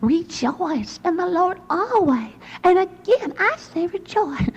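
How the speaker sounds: high, slow, bursting with zest; Missus Holbrook's zest. Retold or imitated. Imitating the Sunday school teacher's zesty verse, high and excited. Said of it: "says it with a lot more zest and excitement".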